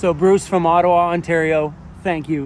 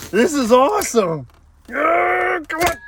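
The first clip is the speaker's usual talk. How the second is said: high, thrilled cheer; excited cheer; 10 semitones higher, 4 dB louder than usual. Real, not acted. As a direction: cheer it out, genuinely pumped. Excited over a cool plate.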